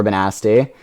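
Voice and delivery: ordinary talk, nothing marked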